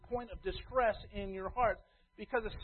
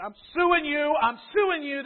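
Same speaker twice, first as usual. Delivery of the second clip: mock angry threat; 6 semitones higher, 10 dB louder than usual. Quarrelling church members voiced.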